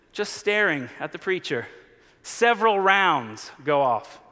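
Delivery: normal talking voice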